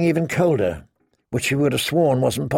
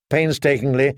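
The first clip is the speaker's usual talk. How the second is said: plain reading voice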